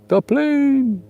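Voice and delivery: mock Fantasy Island sidekick calling the boss; Fantasy Island impression. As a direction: shout it like Tattoo spotting the plane. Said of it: "remind you of Fantasy Island".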